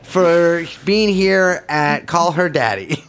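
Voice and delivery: slow, laughing sign-off; hammy host farewell; mock podcast outro. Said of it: "podcast sign-off".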